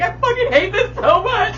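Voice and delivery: high, loud exasperated cry; retold frustrated outburst. Venting to Allie about an awkward night, exasperated. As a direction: blurt it out loudly, fed up, reenacting it.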